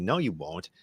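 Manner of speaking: ordinary talk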